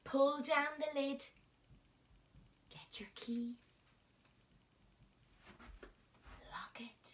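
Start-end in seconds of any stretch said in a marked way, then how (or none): 2.7-7.1 hushed conspiratorial whisper to the class; secret whisper. Acted. Teacher whispering the magic box ritual to children.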